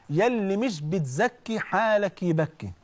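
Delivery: slow, weighty correction of يا اللي مش بتزكي; slow corrected proverb. Shown as a demonstration. Preacher gives the congregation the corrected proverb, slow and deliberate. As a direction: spell out the right version, drawing out مش بتزكي. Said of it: "المثل ده غلط يا جماعة".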